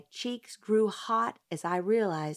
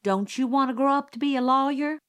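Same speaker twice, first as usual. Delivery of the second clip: high, quick, loud needling; teasing busybody; 7 semitones higher, 6 dB louder, faster than usual. Acted. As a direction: needle the kid, fast and bright. Gossip teasing the child publicly.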